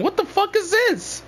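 shocked. Real, not acted. Shocked at friend's house.

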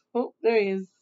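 plain reading voice

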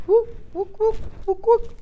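high whooping monkey calls; high monkey hoots. Acted. Monkey sound in story.

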